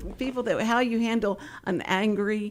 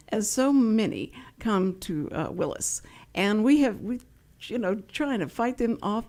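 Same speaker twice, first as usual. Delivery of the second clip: ordinary talk, nothing marked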